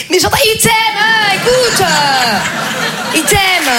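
high, drawn-out call across the carriage; exasperated relay shout. Acted. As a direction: yell it for someone who can't hear. Acted bystander shouting over metro. (cri aigu exaspéré par-dessus le bruit du métro)

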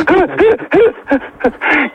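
real high-pitched burst of laughter. Real, not acted. Shopkeeper cracking up at the absurd joke, laughing.

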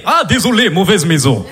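put-on low Russian accent with a laugh; Russian-accented apology. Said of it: "l'accent comme ça".

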